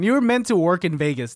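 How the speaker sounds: ordinary talk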